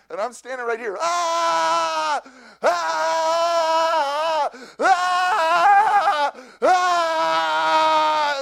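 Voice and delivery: high, loud mock wailing ahs; hammed-up howling cries; imitated wail. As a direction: wail like a disturbed man bursting in. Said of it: "and he goes".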